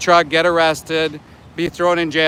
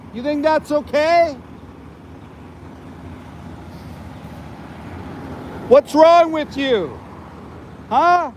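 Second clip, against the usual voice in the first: shouting at the truck driver in the heat; yelling at the driver; 9 semitones higher than usual. Real, not acted. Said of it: "Sorry for the yelling".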